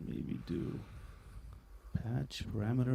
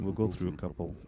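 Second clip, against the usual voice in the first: ordinary talk, nothing marked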